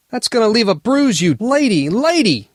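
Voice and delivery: high, indignant yelping complaint; pained outburst. Acted. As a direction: yelp it while clutching the shoulder. Elf yelps after being struck.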